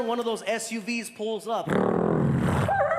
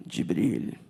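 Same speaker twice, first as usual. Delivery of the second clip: dignified prince. Retold or imitated. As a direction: imitate a regal prince, deep and measured. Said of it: "And he's like".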